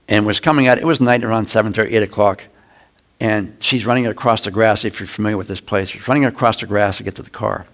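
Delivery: ordinary talk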